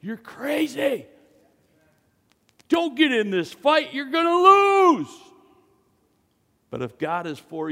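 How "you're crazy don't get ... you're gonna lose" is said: high mocking warning rising to a shout; scoffing siblings. Siblings telling him to quit the fight, mocking shout. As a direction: scoff him down, end in a yell. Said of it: "your very own brothers and sisters will tell you".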